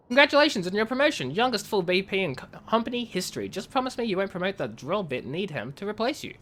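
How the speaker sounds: lightly voiced in-character message read; reading game messages. Streamer reads a miner's report to chat, lightly acted. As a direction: read the colony log like a letter home.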